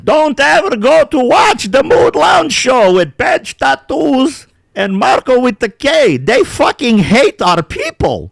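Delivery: high, loud, slow hammed-up Balkan Dad; mock the vlogger's warning, overblown; Balkan Dad impression. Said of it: "Balkan Dad says".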